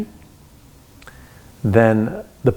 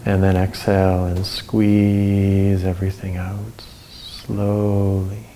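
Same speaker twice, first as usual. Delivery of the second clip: guided breathing. Acted.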